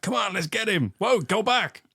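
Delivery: high-pitched urgent calling out, hammed up; comic pursuers shouting; mock rallying cries. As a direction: act out a gung-ho charge turning to sudden retreat. Acting out pursuers charging then retreating.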